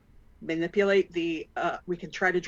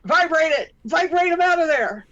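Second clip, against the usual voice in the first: high, loud, excited yell; egging-on shout; 9 semitones higher, 8 dB louder than usual. Pretend. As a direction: shout it like cheering on a stunt. Player egging on friend's power.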